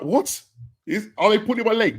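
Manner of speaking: high-pitched retold disbelief; astonished "what?" in a high voice; incredulous. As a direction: replay your disbelief, voice jumping right up. Retells disbelief at big news.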